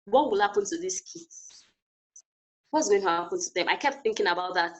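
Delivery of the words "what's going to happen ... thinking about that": usual speaking voice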